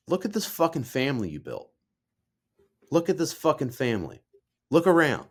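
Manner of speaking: high, insistent retold exclamation; emphatic dad. Dad showing the apprentice their family, emphatic. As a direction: drive it home as Dad, pitch lifted. Acting out dad's emphatic praise.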